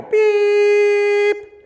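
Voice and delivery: long, very high beep; one long whistle beep. Retold or imitated. The handler's stop whistle, a long high beep. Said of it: "the old man in the back go".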